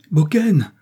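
high, loud alarmed call; cried out. A narrator's reading. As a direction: cry the master's name in alarm. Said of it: "cria Saurin en se précipitant".

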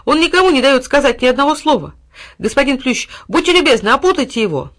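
high, loud exasperated order; commanding boy. A narrator's reading. Boy ordering the ivy to bind the dog. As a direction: demand it at full voice.

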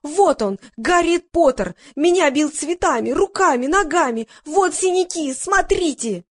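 high-pitched wail of complaint; wailing outcry. A narrator's reading. Woman accusing Harry Potter of beating her, wailing. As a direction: cry it out high, like a wronged victim. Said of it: "заголосила".

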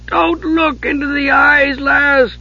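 high, desperate howl; howling warning. Acted. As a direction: howl at her not to look. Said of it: "Dugan howled".

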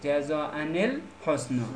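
"جزاء الحسنا" slowly as jaza'ani-l-husna; inserted nun. Shown as a demonstration. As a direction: create a "ni" between "جزاء" and "الحسنا".

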